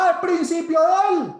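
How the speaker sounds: very high, loud drawn-out yell; mock full-voiced cry; acted street shout. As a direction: holler it like a random man in the street. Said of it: "va por la calle y grita".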